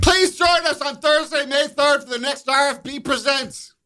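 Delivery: high, bright ad read; high announcer voice. Acted. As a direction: read it like a radio promo. Same words reread aloud, high.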